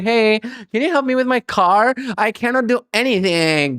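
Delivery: put-on high, slow, sugary helpless girl voice; helpless girly plea. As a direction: ham up a damsel asking for help. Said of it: "you're Reese Witherspoon wearing something pink".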